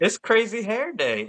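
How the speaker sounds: high-pitched, insistent mock explanation; high, emphatic comeback in a retold exchange; emphatic retort. Parent retells explaining hair to staff.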